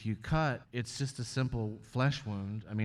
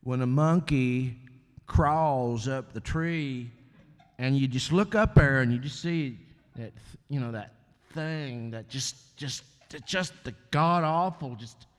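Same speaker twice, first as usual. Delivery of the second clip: slow, halting Texan rambler; Rick Perry impression; 3 semitones higher, 3 dB louder, slower than usual. Mocking Rick Perry's debate rambling for the host, slow and halting. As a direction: drag it out like a lost Texas governor on stage. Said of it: "he'd be up there going".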